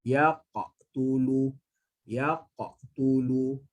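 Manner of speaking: Yaqatulu very slow, lips unsmiling, qaf bounced; slow recitation demo. Shown as a demonstration. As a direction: recite Yaqatulu slowly without smiling. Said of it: "saya tak senyum".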